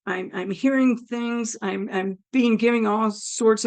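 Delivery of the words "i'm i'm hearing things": ordinary talk, nothing marked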